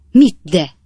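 loud, indignant bellow. A narrator's reading. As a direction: throw the question back, loud and cross. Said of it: "bömbölte a kapitány".

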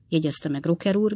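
ordinary delivery

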